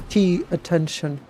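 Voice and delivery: usual speaking voice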